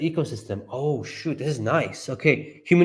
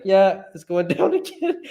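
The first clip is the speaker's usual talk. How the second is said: mock Elon; 6 semitones higher than usual. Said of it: "go like".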